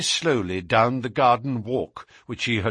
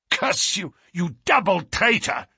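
high-pitched outburst at a traitor; enraged captive crying betrayal; furious cry; 9 semitones higher than usual. A narrator's reading. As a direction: spit out the curse, pitch leaping. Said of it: "cried the German".

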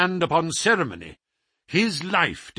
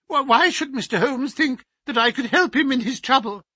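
much higher and louder, jolted; startled high voice; 11 semitones higher, 4 dB louder than usual. Acted. The scholar startled by the doctor's request, high and sharp. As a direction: jump on the word in alarm.

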